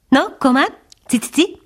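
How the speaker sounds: high, bright tinkling bird voice; tinkling titmouse. A narrator's reading. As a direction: chime it like a little bird. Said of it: "csilingelt a cinege".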